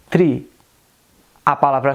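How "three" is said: "three" with T in place of TH; Indian English accent. Shown as a demonstration. Teacher showing the dropped TH, Indian accent.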